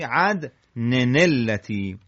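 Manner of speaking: slow عدنن التی as "adne-NEL-lati"; joined tanween. Shown as a demonstration. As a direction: lean on the linking "nel".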